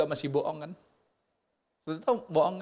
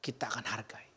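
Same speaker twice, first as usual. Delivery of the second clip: whisper; 6 semitones lower, 8 dB quieter than usual. Acted. Hushed conclusion.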